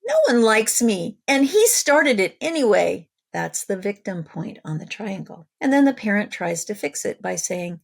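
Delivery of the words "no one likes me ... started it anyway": put-on loud, higher wounded child voice; whiny child crying out. Sibling crying out to the parent, loud whiny child. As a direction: wail it like a kid who feels picked on. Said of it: "One of the children cries out".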